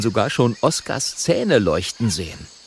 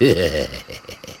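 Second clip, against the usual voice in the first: low villainous chuckle; low, mocking laugh; gloating panther laugh; 6 semitones lower than usual. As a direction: chuckle low over your trapped prey. Panther gloats over trapped pair.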